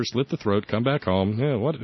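normal talking voice

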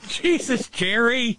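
high, startled protest; shocked exclamation. Real, not acted. Co-host reacting to Jerry's raunchy plans, shocked exclamation.

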